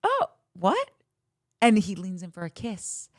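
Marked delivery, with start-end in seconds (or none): 0.0-0.9 imitated coy surprise. Bachelor answering a shy confession, imitated surprise. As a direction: play him pretending he missed it, pitched up. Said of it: "that he's like".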